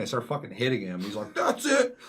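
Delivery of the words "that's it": very high, loud imitated yell; mock outburst. Gunman losing patience before shooting, yelling. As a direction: snap into a shrieked last straw.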